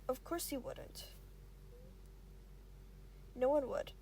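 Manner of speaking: plain reading voice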